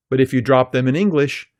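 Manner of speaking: ordinary talk, nothing marked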